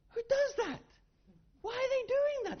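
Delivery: amazed disbelief. Acted. Amazed at the travellers.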